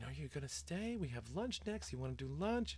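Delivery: high-pitched imitation of coaxing teachers; sing-songy phony voice. Teachers coaxing a girl to stay for lunch, phony and sing-songy. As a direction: coax in a fake, high singsong. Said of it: "That, that whole sing-songy, phony voice".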